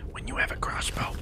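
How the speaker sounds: unvoiced sneaky whisper. Real, not acted. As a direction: whisper it like you're hiding. Hushed while sneaking with crossbow.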